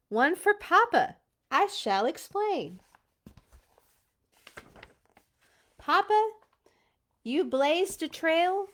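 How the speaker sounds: lively storybook read-aloud; reading to children. Acted. Parent reading a Bear Scouts book to kids, lively. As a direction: bring the rhymes to life for kids.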